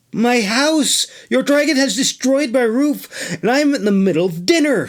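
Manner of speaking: high, indignant fussy mender; outraged, fast at the end; huffy high complaint; protesting. Acted. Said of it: "she protested".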